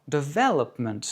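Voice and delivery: English "development", quick de, strong VEL, light ending; stress on second syllable. Shown as a demonstration. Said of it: "accent sur la deuxième".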